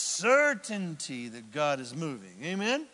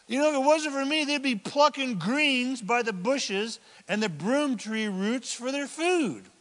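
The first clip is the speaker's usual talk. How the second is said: normal talking voice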